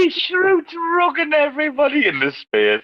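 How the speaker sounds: words squeezed out through laughter; laughing. Real, not acted. Host to the room after the carbon credits joke, laughing. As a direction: still cracking up while talking.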